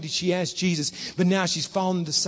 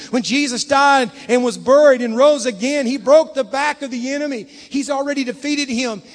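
high, driving sermon delivery; full-force preaching; 7 semitones higher, 7 dB louder than usual. Real, not acted. Pastor rallying the church against the enemy, full-force preaching. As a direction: preach it urgently, building to a peak and easing.

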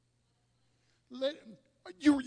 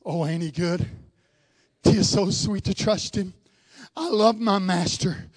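tender devotion; 7 semitones lower than usual. Real, not acted. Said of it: "tender devotion".

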